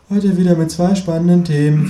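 high, bright host welcome; talk show host. Acted. TV host opening the talk show, presenter voice. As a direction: announce it like a TV presenter.

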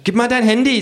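high, fast kangaroo. A narrator's reading. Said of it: "sagt das Känguru".

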